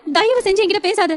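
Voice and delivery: high, anguished anger; frenzied, tearful rejection. Acted. Wife telling husband to get out of her sight, tearful fury. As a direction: push him away with a high, hurt voice. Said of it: "Shivanya ஆவேசமாக".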